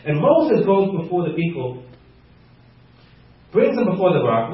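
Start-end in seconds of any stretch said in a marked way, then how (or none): none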